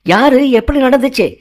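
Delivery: high, rising startled questions; shocked. Acted. Venkat hearing of a murder on the phone, shocked. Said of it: "அதிர்ச்சியான வெங்கட்".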